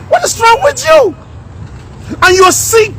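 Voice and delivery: exasperated outburst. Real, not acted. Rant at African presidents.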